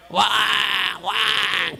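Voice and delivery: high, drawn-out mock "waah"; imitated baby wail. Imitating a child coming out of the womb, high mock wailing. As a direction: mimic a newborn bawling. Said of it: "crying, loudly crying".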